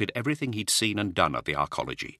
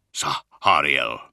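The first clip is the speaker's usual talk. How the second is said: rough voice; 3 semitones lower, 4 dB louder, much slower than usual. Acted. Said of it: "His voice was rough".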